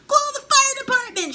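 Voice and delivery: high, very loud panicked yell; sudden shout. A narrator's reading. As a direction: scream for emergency help. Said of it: "she suddenly shouted".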